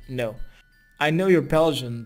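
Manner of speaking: ordinary talk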